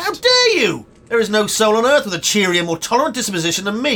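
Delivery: high, loud, theatrical outrage; indignant outburst. Acted. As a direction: explode as if mortally insulted. Outraged at being called argumentative.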